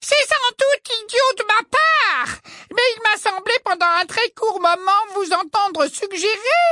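put-on very high, sugary girlish voice; simpering little girl voice. A narrator's reading. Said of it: "d'un ton minaudant".

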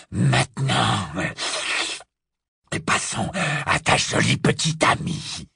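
low then breathy gloating; low gloating werewolf eyeing the girl; relishing werewolf. A narrator's reading. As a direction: savour the threat like a predator. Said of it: "La délectation qu'on sentait".

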